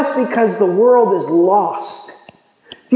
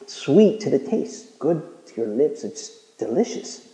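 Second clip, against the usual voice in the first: softer and lower, down to a whisper; fading to a whisper; 5 semitones lower, 8 dB quieter than usual. Real, not acted. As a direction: let it melt away into a whisper. Savoring God's word, fading.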